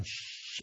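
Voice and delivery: imitated gas burner at low; soft gas hiss. Potter turning on kiln gas, soft imitated hiss. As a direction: hiss softly like gas coming on. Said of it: "turn the gas on".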